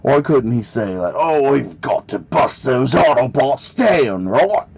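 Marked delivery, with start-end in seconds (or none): 1.2-4.7 slow, higher-pitched put-on British accent. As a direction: play a robot hero drawling in exaggerated British. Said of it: "Why didn't he get a British accent?".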